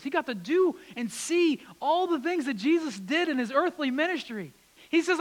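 loud, high preaching build; preaching at full force. Acted. Preacher to congregation, loud and fervent. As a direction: drive the point home with rising fervor.

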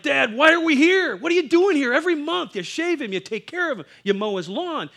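put-on a twelve-year-old boy yelling at his dad; angry demand. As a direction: confront your father, shouting. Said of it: "and I'm like".

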